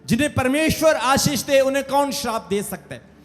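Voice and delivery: high, ringing rhetorical question; emphatic proclamation. Acted. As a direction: proclaim it to the whole congregation. Repeats the answer with raised force.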